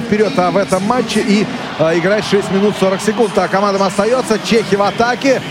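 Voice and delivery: ordinary talk, nothing marked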